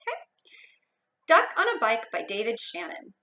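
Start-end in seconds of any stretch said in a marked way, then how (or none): 1.3-3.1 put-on lively read-aloud for young kids; expressive picture-book narration; storybook reading.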